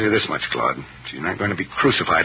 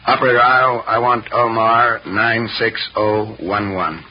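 very slow, spaced-out number; dictating to operator; 3 semitones higher, 4 dB louder, slower than usual. Acted. Brother asking the operator for a number, slow dictation. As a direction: raise the voice slightly and space each digit.

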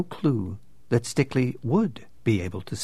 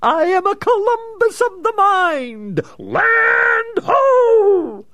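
high, loud triumphant bellow; boisterous shout; 8 dB louder, much slower than usual. Acted. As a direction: proclaim it like an explorer. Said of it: "Boisterously he added".

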